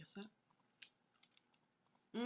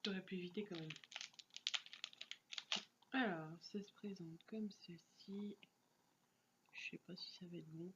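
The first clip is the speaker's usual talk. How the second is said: whispering; 3 semitones lower, 9 dB quieter than usual. Real, not acted. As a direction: whisper it under your breath. Quiet remark while unwrapping.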